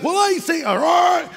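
high, whiny all right; mock girl crying. Girl answering a guy, high whiny voice. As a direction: give in through fake tears. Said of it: "and if you come back".

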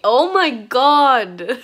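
loud, slow laughing exclamation. Real, not acted. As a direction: burst out laughing on it. Laughing fit.

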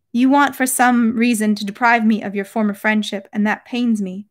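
usual speaking voice

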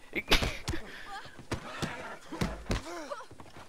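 airy giggle rising away; can't-stop giggling; breathless high laugh; long high wheezing laugh. Real, not acted. Laughing at his own joke.

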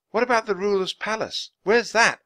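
put-on high-pitched, curious girl's voice; eager girl Annie. A narrator's reading. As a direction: ask eagerly, pitched well above the narration.

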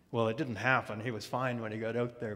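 normal talking voice

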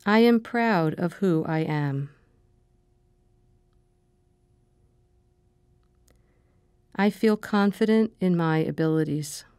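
calm, measured meditation voice, short phrases with long pauses; guided meditation. Acted. Meditation leader giving affirmations to repeat after her, calm and measured. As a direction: guide them gently through breathing and affirmations, unhurried.

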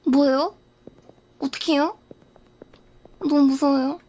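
ordinary delivery

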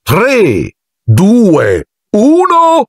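countdown shout. A narrator's reading. Lockhart starting the duel, shouting the countdown. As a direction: call the duel start like a showman. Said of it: "gridò".

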